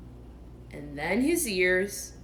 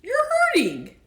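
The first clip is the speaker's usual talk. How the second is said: high, louder pained whine; complaining Pooh; 9 dB louder than usual. Acted. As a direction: complain as he tugs your paw. Pooh in pain.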